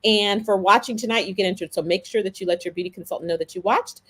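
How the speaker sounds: usual speaking voice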